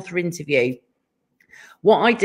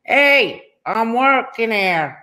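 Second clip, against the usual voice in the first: slow, drawn-out mock New York accent; bad New York accent; 2 semitones higher, slower than usual. Wife imitating husband's impression, hammed-up New York accent. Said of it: "New York. When we were in New York, he was literally going".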